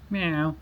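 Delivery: imitated meow. Meowing at the cat.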